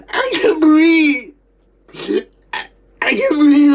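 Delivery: loud, high, drawn-out cry; panicked wail. Retold or imitated. Child who can't breathe, crying out in panic. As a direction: wail it, desperate for air. Reliving being unable to breathe.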